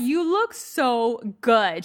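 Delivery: slow, stretched-out admiring line; lightly imitated coworker stretching out the praise; drawn-out compliment. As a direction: drag each word out, mock-gushing. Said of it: "she's like".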